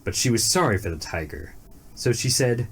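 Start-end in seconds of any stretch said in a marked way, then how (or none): none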